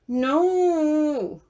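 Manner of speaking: high, loud whiny protest; whiny child. Acted. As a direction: whine it like a little kid. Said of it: "You sound like a whiny little kid".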